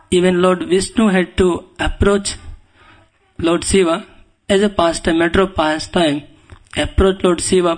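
normal talking voice

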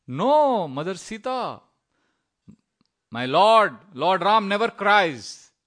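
loud, drawn-out declaration; emphatic protest. Acted. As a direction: protest with full conviction, stretching the words. Lakshman firmly protesting to Sita.

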